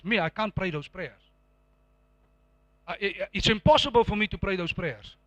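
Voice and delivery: ordinary talk, nothing marked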